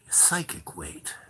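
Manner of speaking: eerie slow whisper. Retold or imitated. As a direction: whisper it slowly, as the strange man. Retold strange man, whispered.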